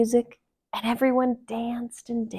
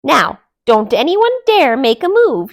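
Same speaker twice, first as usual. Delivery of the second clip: demanding; 5 semitones higher, 9 dB louder than usual. Acted. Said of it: "she demanded".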